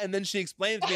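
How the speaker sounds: ordinary delivery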